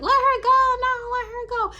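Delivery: high, drawn-out mock call; imitated friend's plea. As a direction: call out high and loud, half in fun. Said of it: "Carolina is laughing like".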